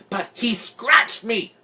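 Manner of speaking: slow, high-pitched wail; aggrieved, high drawn-out cry; indignant protest. A narrator's reading. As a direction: whine the complaint with outrage. Farrah protesting her injury.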